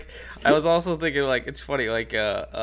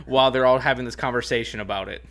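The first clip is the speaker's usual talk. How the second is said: ordinary talk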